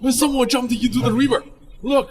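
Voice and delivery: high, loud cry of alarm; alarmed shout. Acted. Bystander shouting that someone jumped into the river, alarmed. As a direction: call the crowd's attention.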